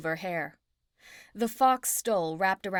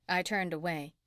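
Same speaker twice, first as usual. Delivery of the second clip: ordinary delivery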